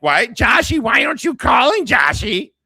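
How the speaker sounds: high, loud mocking mother impression; mock mom voice. [name] mimicking speaker's mom calling Joshy, high mocking mother voice. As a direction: put on a high, hollering mom voice. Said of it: "do her voice".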